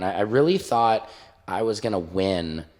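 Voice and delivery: ordinary talk, nothing marked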